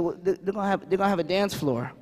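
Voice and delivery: low, soft, hesitant confession; subdued, stumbling child's admission; reluctant little boy. Retold or imitated. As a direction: imitate a kid quietly admitting a worry. Reluctant nephew confides his worry.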